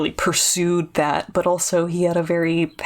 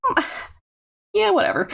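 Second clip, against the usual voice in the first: dismissive; 14 semitones higher, faster than usual. Retold or imitated. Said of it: "So I'm like".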